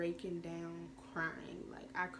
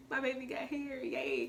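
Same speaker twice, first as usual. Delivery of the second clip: excited squeal; 7 semitones higher, 3 dB louder than usual. Retold or imitated. Thrilled at baby's hair.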